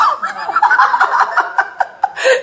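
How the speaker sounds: a breathless high laugh; real laugh bursting out mid-sermon; airy high laugh. Real, not acted. Pastor laughing with the congregation, breathless high laugh. Preacher laughs at own point.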